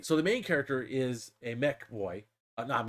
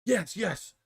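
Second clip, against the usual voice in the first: higher-pitched mock bluster; imitated orc making excuses; defensive orc boss; 5 semitones higher, 5 dB louder than usual. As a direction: bluff to cover a blunder. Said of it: "He's like".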